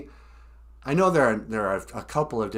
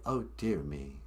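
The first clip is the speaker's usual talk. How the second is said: [name] impression; 9 semitones lower, 9 dB quieter than usual. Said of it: "sometimes he'll say".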